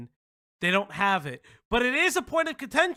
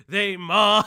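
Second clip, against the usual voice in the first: normal talking voice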